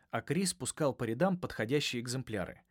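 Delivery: plain reading voice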